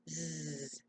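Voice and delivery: "Z" said as a long buzzing "zzz"; "Z" as its phonics sound, softly buzzed; letter sound. Shown as a demonstration. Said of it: "Say Z".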